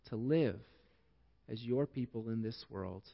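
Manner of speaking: low, soft, measured prayer voice; hushed, reverent public prayer, sinking to a soft Amen. Real, not acted. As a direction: pray quietly, pausing between phrases. Leads closing prayer.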